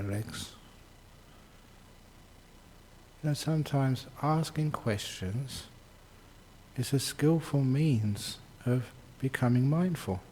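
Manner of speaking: soft, unhurried meditation guidance; quiet, easy voice with lots of space; guided meditation. Real, not acted. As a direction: talk them gently through a body check, leaving long pauses.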